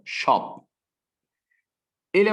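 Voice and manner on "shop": "Shop" as one short, unbroken syllable; all in one go. Shown as a demonstration. Teacher showing it is monosyllabic, "Shop" said at once. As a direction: say "Shop" in a single beat. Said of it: "Eu falo ele de uma vez só".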